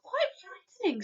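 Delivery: put-on high scared child; frightened cry. A narrator's reading. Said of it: "Sophie cried".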